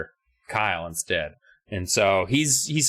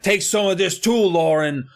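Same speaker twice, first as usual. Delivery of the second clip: high, loud playacted attack; mock taunting yell; 8 semitones higher, 4 dB louder than usual. Twins spraying Lauren with super soakers, mock taunting yell. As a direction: yell it like a bratty kid in battle. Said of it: "they're just like".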